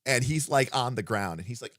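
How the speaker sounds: ordinary talk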